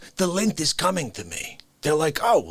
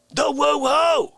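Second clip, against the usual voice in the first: shouted woohoo; 9 semitones higher, 5 dB louder, slower than usual. Acted. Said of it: "comic rant".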